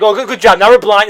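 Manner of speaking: imitated irate bystander, loud; mock angry sarcasm. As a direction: berate the useless hero sarcastically. Crowd angrily berates Booster Gold.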